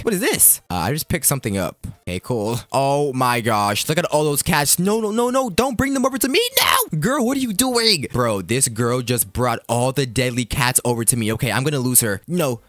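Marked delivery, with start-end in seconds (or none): 4.8-8.1 high-pitched frantic pleading, hammed up; mock-panic cry rising high; panicked protest. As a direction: beg them to keep danger away. Girl brings deadly cats over.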